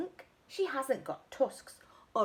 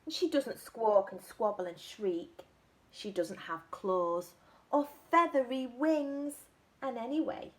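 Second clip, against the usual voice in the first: usual speaking voice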